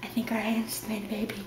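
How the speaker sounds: soft unvoiced whisper. Real, not acted. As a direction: whisper it like a secret. Whispered joke.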